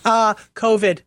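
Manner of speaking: ordinary talk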